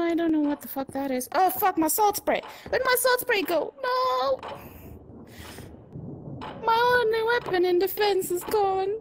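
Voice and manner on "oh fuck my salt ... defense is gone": panicked. Real, not acted. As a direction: yelp in panic as the weapon vanishes. Loses weapon, panics.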